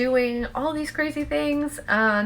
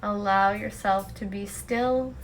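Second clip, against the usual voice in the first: guided meditation; 7 dB quieter than usual. Acted. Host guiding listeners to let go and just be, guided meditation. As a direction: lead them gently into stillness, leaving long pauses.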